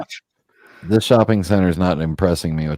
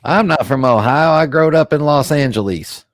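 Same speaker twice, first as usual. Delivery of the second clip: mock Southern accent; 6 semitones higher, 4 dB louder than usual. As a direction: play a hammy Southerner. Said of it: "that your real accent".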